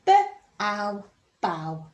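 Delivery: B, ow said apart then blended into bow; sounding out. Shown as a demonstration. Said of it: "Sound it out".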